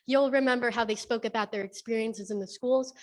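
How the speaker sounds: usual speaking voice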